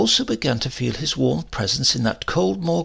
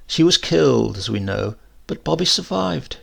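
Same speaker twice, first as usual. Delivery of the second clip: ordinary delivery, nothing marked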